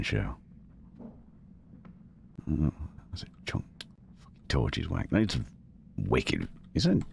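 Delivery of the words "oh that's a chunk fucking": hushed whisper so the mic stays quiet. Real, not acted. Streamer whispering at his screen to keep quiet. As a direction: breathe it out, barely voiced. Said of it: "trying my hardest not to trigger this mic".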